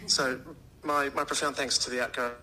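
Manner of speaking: ordinary delivery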